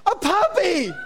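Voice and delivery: very high, hammed-up squeal; over-the-top mock excitement; sucker's delight. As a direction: shriek it like a gullible puppy lover. Imitates a sucker falling for it.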